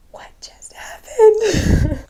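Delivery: slow, breathless high whisper; stunned whisper. Retold or imitated. Two missionaries look at each other after the gun, slow stunned whisper. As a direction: whisper it in shock.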